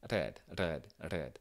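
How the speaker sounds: red with an Italian rolled R; Italian accent. Shown as a demonstration. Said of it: "avec un accent, euh, italien".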